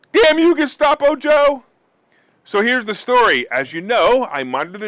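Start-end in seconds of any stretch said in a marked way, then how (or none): 0.1-1.6 high, loud, hammed-up cursing yell; theatrical cry of blame; mock outrage. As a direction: curse the culprit at the top of your voice. Mock outrage over canceled flight.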